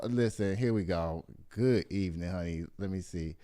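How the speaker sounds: low, soft mumbling under her breath; muttering to herself. Real, not acted. Host hunting for the new photo, low and soft under her breath. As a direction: grumble quietly while searching.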